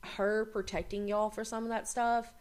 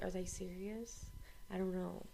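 much softer, muttered bystander aside; puzzled onlookers; 2 semitones lower, 14 dB quieter than usual. Retold or imitated. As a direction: mumble it like confused onlookers. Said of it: "And then everyone's like".

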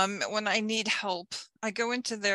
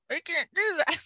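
giggling through it, higher; laughing; 10 semitones higher, 4 dB louder than usual. Real, not acted. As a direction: laugh while saying it. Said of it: "My eyes should be a little more crossed".

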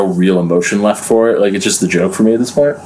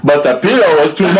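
usual speaking voice

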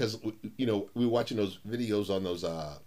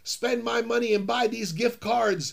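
retold frantic yelling; 11 semitones higher, 4 dB louder than usual. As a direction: demand to be allowed to pay, frantic. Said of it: "I was screaming with Amazon".

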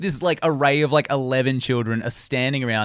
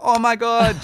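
higher, half-hearted panic; mild mock freak-out; 9 semitones higher, slower than usual. Mimicking the girl on drugs, mild mock panic. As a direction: imitate a tame freak-out, pitched up. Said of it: "that dialed back down to two".

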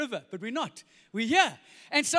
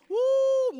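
high, loud joyful whoop; excited whoop; 14 semitones higher, 8 dB louder than usual. Real, not acted. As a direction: burst out in delight. Preacher exclaims about heaven.

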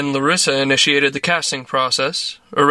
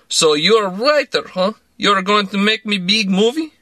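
put-on higher, playful Russian accent; teasing Russian man; 5 semitones higher than usual. A narrator's reading. Misha teases the writer about a movie, higher, playful Russian accent. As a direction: needle the writer with broken English.

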